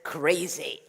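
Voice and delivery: usual speaking voice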